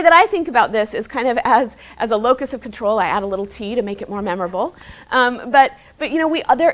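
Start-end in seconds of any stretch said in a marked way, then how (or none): none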